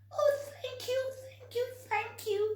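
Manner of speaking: very high, excited squeaks; squeaky thanks. Acted. Baby mice squeaking thanks to George, excited. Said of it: "they squeaked".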